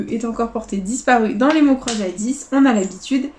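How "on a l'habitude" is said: ordinary talk, nothing marked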